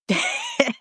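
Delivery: a sudden high burst of laughter. Real, not acted. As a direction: break into a high, squeaky laugh. Laughing at Blanche's reply.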